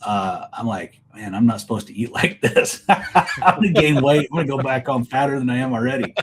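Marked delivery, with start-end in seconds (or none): 1.1-6.1 joking through laughter about gaining weight; laughing it out. Retold or imitated. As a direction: chuckle through the words, playfully. Joking about overeating at hunting camp.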